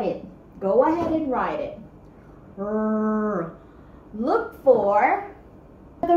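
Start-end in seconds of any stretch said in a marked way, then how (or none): 2.6-3.6 R said as a short growled rrr; letter sound R. Shown as a demonstration. Teacher isolating the sound R for kids, a short rrr. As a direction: give just the sound R, rrr. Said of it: "What sound does the word red begins with".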